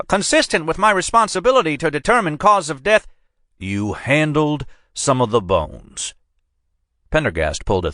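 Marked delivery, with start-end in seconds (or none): 0.1-3.1 put-on much higher, flustered hedging old man; examiner hedging. A narrator's reading. Examiner hedges to the agent, high and flustered. 3.6-6.1 slow, falling flat "handled"; disgusted statement. A narrator's reading. Agent restates it slowly in disgust, "handled" falling. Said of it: "shaking his head in disgust".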